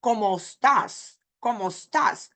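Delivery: estás cut to "stas" after cómo; dropped vowel. Shown as a demonstration. Teacher showing what we don't say, dropped e. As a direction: run it as "komo stas". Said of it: "We also don't say".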